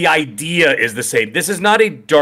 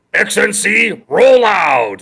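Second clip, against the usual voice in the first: slow, loud, higher robot command; robot commander shout; 6 semitones higher, 4 dB louder, much slower than usual. Acted. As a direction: boom it out like a robot leader. Transformer catchphrase, shouted slow.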